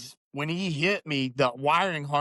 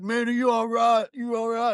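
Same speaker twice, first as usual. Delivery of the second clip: higher, anxious check-in; the young driver asking if he's all right; worried other driver; 6 semitones higher than usual. Retold or imitated. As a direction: ask urgently if he's okay, voice raised.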